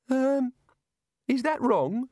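high, hesitant god voice; a nervous deity unsure he erred; anxious god. A narrator's reading. Narrator voices the god anxiously asking the wizards, high and hesitant. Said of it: "said the god anxiously".

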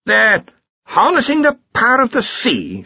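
put-on high, hearty old wizard voice; blustering archchancellor. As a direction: boom it out like a bluff old boss. Ridcully, the archchancellor, speaks.

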